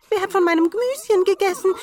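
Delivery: sehr hoch, laut und schnell; vierter Zwerg. A narrator's reading. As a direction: aufgeregt hoch losplappern. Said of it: "Der vierte". (hohe, laute Zwergenstimme)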